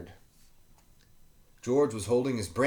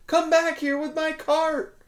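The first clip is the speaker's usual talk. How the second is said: high, louder desperate call; shouting after him; 6 dB louder than usual. Acted. Volunteer chasing George's runaway cart, high loud call. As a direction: call him back in alarm. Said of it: "a librarian volunteer shouted".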